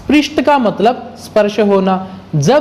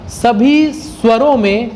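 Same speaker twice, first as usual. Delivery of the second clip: usual speaking voice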